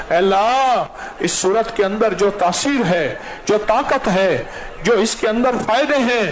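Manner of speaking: high, pleading supplication rising to a cry; impassioned prayer. Acted. Imam pleading with God for the gathering, fervent and high. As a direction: beg God with full fervour.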